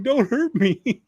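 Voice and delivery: high-pitched cowering plea; mock pleading yell. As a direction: beg in an exaggerated, high frightened voice. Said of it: "and the guy's yelling".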